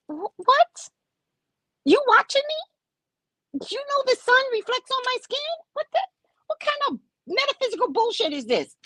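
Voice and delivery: high, disbelieving outburst; incredulous. Real, not acted. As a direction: react in shocked disbelief. Incredulous reaction to message.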